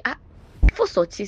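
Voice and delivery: loud, firm command; insistent order. Retold or imitated. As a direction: push her out the door. Stranger orders narrator out.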